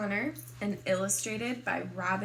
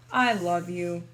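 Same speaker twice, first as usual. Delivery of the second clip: dad; 4 semitones lower, 8 dB louder than usual. Acted. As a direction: slightly lower fatherly voice. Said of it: "my dad says".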